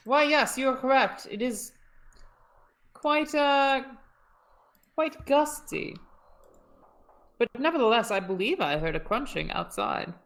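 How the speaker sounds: put-on Shakespearean British accent. Russian guard answering his comrade about the noise, in mock Shakespearean British. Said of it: "Shakespearean Brits".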